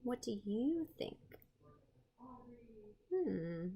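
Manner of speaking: ordinary talk, nothing marked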